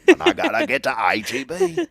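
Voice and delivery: high, hammed-up country twang on the letters; country accent. Host jokes about getting to the store, high country accent. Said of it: "there it is".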